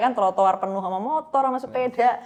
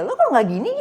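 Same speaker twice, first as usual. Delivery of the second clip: sharper and louder, as if scolding; harsh misread tone; 4 semitones higher, 6 dB louder than usual. Shown as a demonstration. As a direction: read it the way a hostile reader hears it. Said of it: "bukan kayak".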